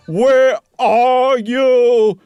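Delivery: high, loud, very drawn-out call. Acted. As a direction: stretch the call out into the empty house. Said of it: "he called out".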